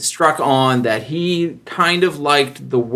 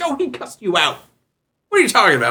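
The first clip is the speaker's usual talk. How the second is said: higher, incredulous retort; mock coworkers; 7 semitones higher, faster than usual. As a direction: coworkers refusing to believe him. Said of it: "And they're like".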